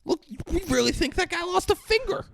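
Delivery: high-pitched disbelief; incredulous mocking. Retelling the diners doubting the cut, high incredulous voice. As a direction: squeal the question in mock disbelief.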